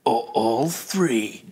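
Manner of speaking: slow, low trailer narration; trailer voiceover. Acted. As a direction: read it like a teasing game trailer, slowly. Reads out game's romance trailer.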